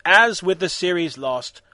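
ordinary delivery

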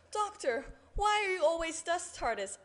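high-pitched questioning character voice; voiced companion character. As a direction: ask it in a high put-on voice. Voicing Amy asking the Doctor.

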